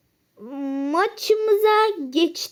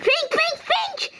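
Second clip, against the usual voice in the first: climbing much higher each call; urgently calling the target's name; frantic calling out; 11 semitones higher, much slower than usual. Real, not acted. (gittikçe tizleşen telaşlı sesleniş)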